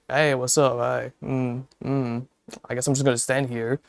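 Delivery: soft, trailing mumble to nobody; awkward mumble. Retold or imitated. Retelling standing awkwardly at the back, soft mumble. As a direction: mumble it like you're stuck at the back alone.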